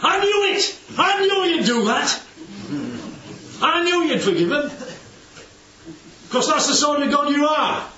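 Jonah's exasperated outburst at God; indignant complaint. Acted. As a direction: hurl it at God as an accusation. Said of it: "He's criticising God for being good".

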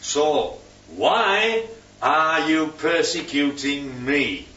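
slow, weighty divine question; big question. Acted. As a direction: draw the question out deliberately. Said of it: "big question".

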